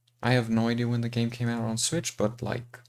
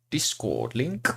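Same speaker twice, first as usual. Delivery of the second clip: odd voice sound; 2 semitones higher, slower than usual. Real, not acted. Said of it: "That was an interesting sound".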